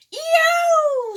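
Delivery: very high loud yelp; pecked dinosaur screaming in pain. A narrator's reading. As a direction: shriek from a peck. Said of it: "screamed Tyrannosaurus".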